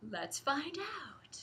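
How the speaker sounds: slow secret whisper; hushed tease before the big reveal; teasing whisper. Acted. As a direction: breathe it like a secret.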